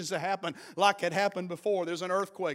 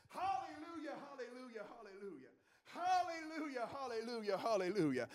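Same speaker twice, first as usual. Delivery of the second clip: soft praise; 3 semitones higher, 15 dB quieter than usual. Real, not acted. Softer praise after loud call.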